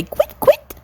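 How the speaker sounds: very high chirped quit; partridge call. Retold or imitated. Father swan imitating the partridge call, very high. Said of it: "the partridge say".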